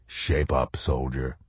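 low, soft command; shadow soldier. A narrator's reading. Shadow soldier ordering the colonel, low and stern.

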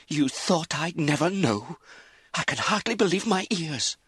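high, forceful disbelief; outraged. A narrator's reading. Daughter unmoved by her mother's tears, outraged. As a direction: throw the excuse back in fury. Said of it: "Lizzie's outrage".

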